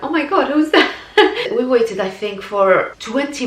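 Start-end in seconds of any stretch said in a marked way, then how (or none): 0.0-0.9 high, startled question; mother startled by her swollen newborn's face; shocked surprise. Retold or imitated. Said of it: "and I was like". 1.0-1.5 short high laugh. Real, not acted. Laughs at her reaction.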